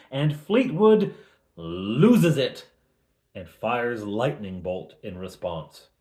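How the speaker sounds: usual speaking voice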